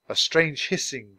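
usual speaking voice

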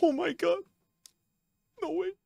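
high, hushed gasping words; stunned disbelief. Real, not acted. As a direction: squeak it softly, barely believing it. Stunned by the pull.

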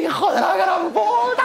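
high, slow mock outrage; hammed-up curse. Bitten rival cursing him, slow high mock outrage. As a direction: drag out the insult in a squeaky rage.

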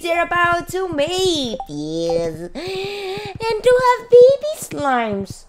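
sweeping up high and slow, almost cooing; odd high voice. Acted. Gamer laughing at her own odd voice, high and slow. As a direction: play it sugary and drawn out. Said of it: "Why am I talking like this?".

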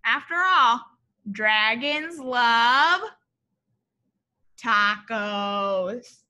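slow, stretched dragons love tacos; drawn-out refrain. A narrator's reading. Teacher reading the title refrain slowly. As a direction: savor each word of the title line. Signature refrain read aloud.

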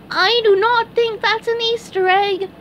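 very high, loud cartoon doubt; doubtful SpongeBob. A narrator's reading. SpongeBob looks up at the huge egg, doubtful. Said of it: "said SpongeBob".

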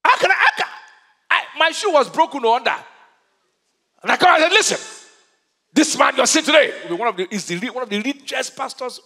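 high, loud incredulous testimony; impassioned preaching. Real, not acted. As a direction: preach it at full force, astonished at the boldness.

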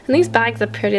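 ordinary delivery

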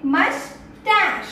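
"मस्टैच" slowly split, mus, TASH; correct pronunciation. Shown as a demonstration. Teacher answering her own question, correct pronunciation. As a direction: stretch "मस्टैच" to end in sh. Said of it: "क्या बोलते हैं".

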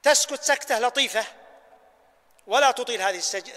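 ordinary talk, nothing marked

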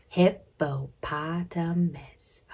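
slow, separated HIP-PO-POT-A-MUS; counting syllables. Shown as a demonstration. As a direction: count out "Hippopotamus" syllable by syllable, softly measured. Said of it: "Let's clap it out".

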